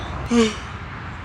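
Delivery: long breathy exhausted sigh; weary sigh. Real, not acted. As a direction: sigh out all the fatigue. Exhausted and hungry after run.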